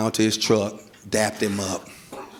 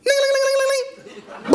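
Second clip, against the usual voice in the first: very high-pitched ringing noise; imitated doorbell. Locked-out husband ringing the doorbell, shrill imitated ringing. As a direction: trill like a frantically pressed doorbell. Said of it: "I'm ringing the doorbell".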